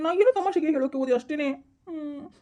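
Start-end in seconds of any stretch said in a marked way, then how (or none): 1.9-2.3 slow, whimpering comic sob; milk the pain, half-blubbering like a hurt kid; mock crying. Slapped friend whining to his buddy, hammed-up crying. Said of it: "ಅಳ್ತಾ ಇದೀಯಾ?".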